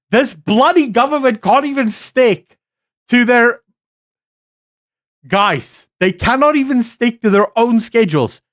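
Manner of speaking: pitched high, heated complaint about the government; exasperated rant. Real, not acted. Vlogger fuming to viewers about the government's schedule, high exasperated rant. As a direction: vent the frustration, voice riding high.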